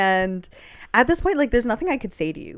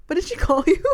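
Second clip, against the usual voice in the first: laughing question; 10 semitones higher, 6 dB louder than usual. Real, not acted. Laughing at the insult.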